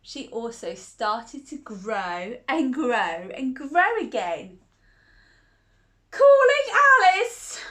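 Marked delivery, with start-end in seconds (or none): 6.1-7.6 much higher, much louder summons; a bossy man summons Alice with a huge high shout. A narrator's reading. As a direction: bellow her name across the courtroom. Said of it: "shouted the bossy man".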